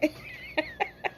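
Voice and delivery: high squeaky burst of laughter. Real, not acted. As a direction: break into a high giggle. Laughing at his own story.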